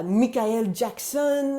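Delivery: Michael Jackson slowly, as "mee-kah-EL jak-SON"; French pronunciation. Shown as a demonstration.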